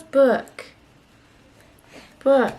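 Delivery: put-on "Book." high and falling, clear for the child; showing a toddler how to say "Book."; modelling a word. Shown as a demonstration. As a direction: model "Book." for her to copy. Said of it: "Like this".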